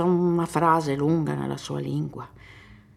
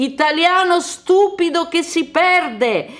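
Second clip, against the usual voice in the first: high, loud, slowish ironic shout; mock himself loudly; high shouted self-deprecating joke; loud self-mockery; 14 semitones higher, 11 dB louder than usual. Self-mocking shout to child.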